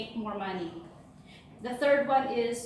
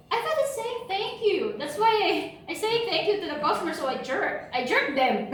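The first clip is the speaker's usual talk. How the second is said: employee's earnest excuse, higher, quick in the middle; imitating the employee; 5 semitones higher than usual. Employee explaining his jerk mistake to his supervisor, lightly voiced. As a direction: innocently explain the mix-up in his voice. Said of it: "And he said".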